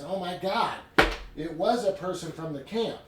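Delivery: high, loud dramatic gasp of a line; shocked realization. Acted. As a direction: act out a reader's sudden, stunned discovery. Acted shocked realization of the reader.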